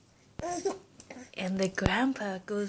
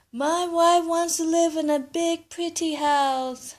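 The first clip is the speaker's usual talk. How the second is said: grandpa asking; 8 semitones higher, 4 dB louder than usual. Acted. Grandpa tells fish.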